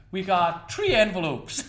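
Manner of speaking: slow, put-on Irish accent. Shown as a demonstration. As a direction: announce it slowly in a stage Irish accent. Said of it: "line from one of your effects in this Irish accent".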